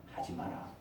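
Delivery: whispered divine no; whispered warning. Acted. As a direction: hush it, firmly. God's voice whispering. (속삭이는 하나님의 경고)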